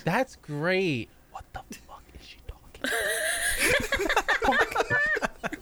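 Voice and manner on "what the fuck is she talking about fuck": hushed then squeak; whispered aside. Pretend. Nurse muttering to herself, whispered aside.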